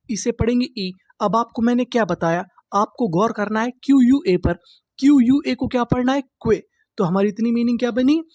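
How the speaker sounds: usual speaking voice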